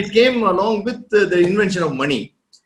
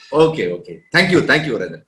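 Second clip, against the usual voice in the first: usual speaking voice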